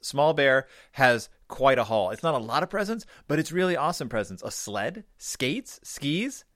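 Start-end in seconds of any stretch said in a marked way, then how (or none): none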